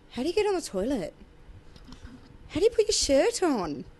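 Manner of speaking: put-on curious kid. Kids ask Steve how he manages, lightly childlike. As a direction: ask like a puzzled little kid. Kids' weird questions to Steve.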